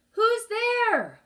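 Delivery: high, loud call into the distance; shouting. Acted. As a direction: shout it out across the water. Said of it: "Minley shouted".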